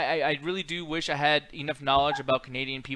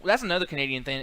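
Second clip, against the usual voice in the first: ordinary delivery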